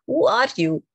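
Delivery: hammed-up indignant outburst; acted angry challenge. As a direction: demand it as an offended guard would. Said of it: "he is so angry".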